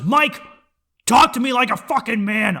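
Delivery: loud, pushy shout at Mike; friend's demanding shout. Retold or imitated. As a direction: bark it like a tough friend. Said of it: "He goes".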